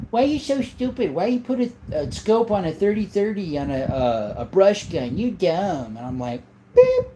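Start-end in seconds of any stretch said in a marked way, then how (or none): 0.1-6.0 high-pitched mocking whiny brat; imitated brat. Retelling a viewer's insult about the scope, mocking brat voice. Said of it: "that was being a brat". 6.7-7.0 high, loud beep; imitated beep. Streamer silencing a bratty viewer, imitated loud beep.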